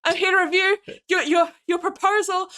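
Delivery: high, fast eager gushing; breathless gushing. Retold or imitated. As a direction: rush in gushing praise. Rushing up stairs, acted.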